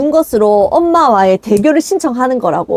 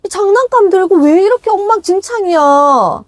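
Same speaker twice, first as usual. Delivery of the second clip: higher, slower grumbling complaint; a husband back from work grumbling at the messy house, pitched up; annoyed husband; 8 semitones higher than usual. Pretend. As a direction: whine like a tired husband home from work. Voicing husband complaining after work.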